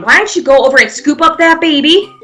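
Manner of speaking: ordinary talk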